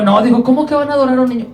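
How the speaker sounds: high, rushed mock indignation; enojo imitado. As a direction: act out an outraged king's rapid question. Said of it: "Y empezó a enojarse él". (indignado, imitando a un rey, rápido y agudo)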